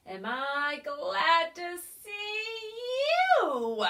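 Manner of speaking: a hint of a sing-song cackle; cackling creature. A narrator's reading. Small creature greets the pig, light cackle. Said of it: "she cackled".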